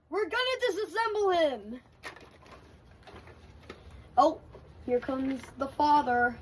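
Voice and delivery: ordinary talk, nothing marked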